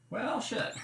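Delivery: sudden high curse at finding none left; a real, sharp high "well, shit"; dismayed curse. Real, not acted. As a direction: blurt it out, caught short. Ingredient not found while cooking.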